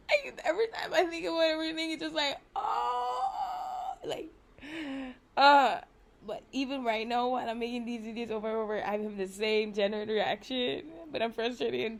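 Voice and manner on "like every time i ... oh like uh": half laugh, half sob, voice breaking; laughing and crying. Real, not acted. Overwhelmed thinking about everything, laughing then crying out high. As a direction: let the tears through the words.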